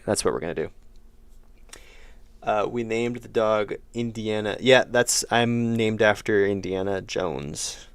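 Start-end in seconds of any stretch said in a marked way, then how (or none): none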